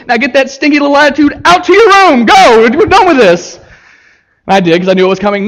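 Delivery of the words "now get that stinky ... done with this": put-on raised, higher voice ordering a child away; scolding mother. Mother sends stinky little attitude to his room, scolding. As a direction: imitate an exasperated mother laying down the law.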